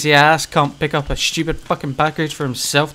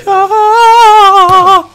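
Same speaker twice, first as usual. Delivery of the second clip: laughing yelp; 14 dB louder than usual. Real, not acted. Hit in game.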